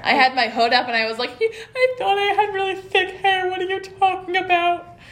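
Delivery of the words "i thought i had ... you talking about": mock weepy protest. Retelling crying at Cecilia's remark, in a mock wail. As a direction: whimper it like a wounded kid, half-joking. Retold crying over thin hair.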